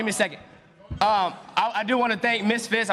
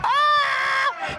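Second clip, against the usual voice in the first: sudden high mocking squeal; mocking high yell; 4 dB louder than usual. Fighter shrieking to mock his rival at the face-off. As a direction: burst out in a high taunting shriek.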